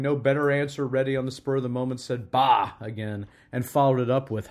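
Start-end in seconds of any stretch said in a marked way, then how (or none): 2.3-2.7 loud, abrupt scoff; dismissive outburst. A narrator's reading. Scrooge lacking a better answer, scoffing loudly. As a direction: blurt it out loudly in contempt.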